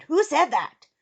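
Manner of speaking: high, loud startled question; startled demand. A narrator's reading. Eric turns toward hidden voice, startled loud demand. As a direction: call out to an unseen speaker.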